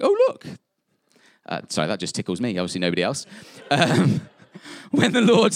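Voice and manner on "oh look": high, loud squeak of surprise; mock surprise. Preacher voicing Moses, high and loud with surprise. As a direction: Moses spotting the bush, squealing in delight. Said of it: "Moses going".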